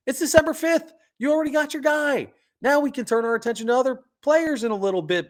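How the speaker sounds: can't contain the excitement; bursting with excitement over the signing; thrilled. Real, not acted. Thrilled about early signing.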